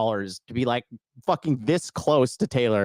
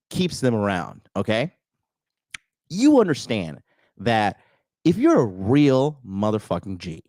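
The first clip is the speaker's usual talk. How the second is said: usual speaking voice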